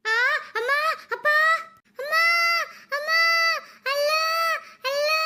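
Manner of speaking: short, high rising cries of pain; peaking labour pains. A narrator's reading. Two women in hard labour calling on mother and Allah, in pain. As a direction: cry out with each contraction. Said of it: "ரெண்டு பேருக்கும் வலி முத்திடுச்சு".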